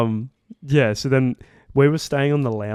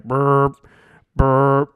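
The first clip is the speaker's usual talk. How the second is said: imitated alert beep; 3 semitones higher, 8 dB louder than usual. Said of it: "it played this sound".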